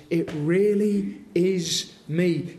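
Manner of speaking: really stretched and stressed, said slowly; emphatic. Acted. Preacher voicing Jesus to the disciples, slow emphatic stress on really. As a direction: lean hard on really, slowly, as Jesus insisting. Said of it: "He cannot be more emphatic linguistically".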